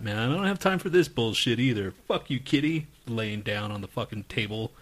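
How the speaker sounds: ordinary talk, nothing marked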